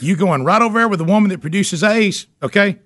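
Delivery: high, giddy mock lecture with laughs; mock-scold the kid, half laughing; hammed-up rant. Dad ranting at son, laughing.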